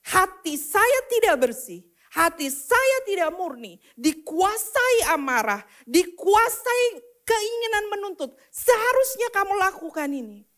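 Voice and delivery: loud, high, surging sermon delivery; impassioned preaching. Real, not acted. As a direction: build the self-accusation forcefully. Preaches at full force. (khotbah lantang berapi-api)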